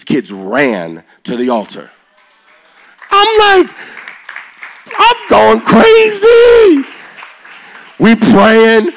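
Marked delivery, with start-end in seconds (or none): 3.1-6.8 high, loud, stretched-out shout of joy; going crazy. Retold or imitated. Preacher reliving the revival, shouting with excitement. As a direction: yell it out like you can't contain it.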